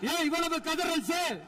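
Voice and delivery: high-pitched mocking questions; taunting the angry opposition benches. Real, not acted. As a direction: needle the opposition for wailing. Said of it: "கொஞ்சம் சவுண்டா பேசுறேன்".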